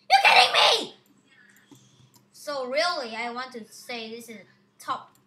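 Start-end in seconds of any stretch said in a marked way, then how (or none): 0.1-1.0 loud, higher disbelieving yell; frustrated gamer yell; exasperated shout. Real, not acted. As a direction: shout it at the screen. Fails again.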